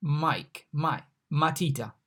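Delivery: Mike then matita, same M sound; comparison demo. Shown as a demonstration. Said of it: "pronounced like M in Mike".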